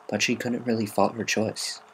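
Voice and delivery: ordinary delivery, nothing marked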